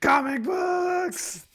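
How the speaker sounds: very high, slow stretched cry; drawn-out wail. Acted. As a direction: wail the words out long and high.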